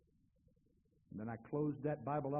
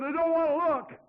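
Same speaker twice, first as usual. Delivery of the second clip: much higher, loud panicked cry; frightened protest; 12 semitones higher, 12 dB louder than usual. Retold or imitated. Scared man refusing to look into hell. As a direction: pull back in terror.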